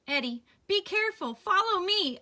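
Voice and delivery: high, loud urgent call; squawked. Acted. Al calling to Eddie while flying, urgent squawk. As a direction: shout it across the sky. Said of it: "Al squawked".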